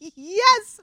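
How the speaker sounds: high, loud burst of yes; excited outburst. A narrator's reading. Harvey blurting the news to the band, excited and loud. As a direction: blurt it out with glee. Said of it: "Harvey ejaculated".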